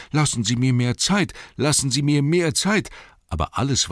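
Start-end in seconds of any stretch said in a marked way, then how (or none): 0.1-2.9 wanting to scream. A narrator's reading. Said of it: "Er wollte schreien".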